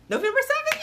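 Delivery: very high thrilled squeal on "November seventh"; excited squeal. Real, not acted. Announcing the date, squealing "November seventh" in delight. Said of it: "I'm excited".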